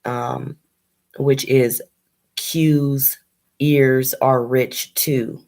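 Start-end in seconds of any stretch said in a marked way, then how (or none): 2.4-5.4 slow, careful dictation; slow dictation of an email address; spelling it out. Shown as a demonstration. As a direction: say each word slowly so they can write it.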